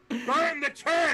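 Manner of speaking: slow, high, loud gleeful cry; gleeful shout. Real, not acted. Player cheering to burn the church, high and slow. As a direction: cheer for burning it down.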